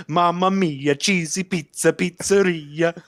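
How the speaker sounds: high singsong Italian chef voice, slowing at the end; jolly Italian pizza chef. Retold or imitated. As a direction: croon it like a happy pizza chef. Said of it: "and he goes".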